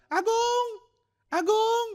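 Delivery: very high squawked "Agung"; cockatoo calling. Retold or imitated. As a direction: call the name like a parrot. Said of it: "langsung bilang".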